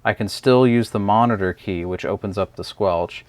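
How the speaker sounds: usual speaking voice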